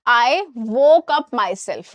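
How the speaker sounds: very slow, each word spaced out; slow wrong example. Shown as a demonstration. Teacher slowly reads out a wrong example. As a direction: spell out the wrong sentence slowly. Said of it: "गलत।".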